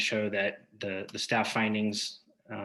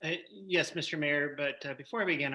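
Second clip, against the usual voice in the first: normal talking voice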